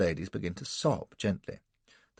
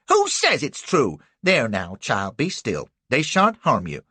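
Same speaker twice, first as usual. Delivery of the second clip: high, loud defiant comfort; fierce little woman; protective old lady; 4 semitones higher, 6 dB louder than usual. A narrator's reading. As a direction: shield the girl.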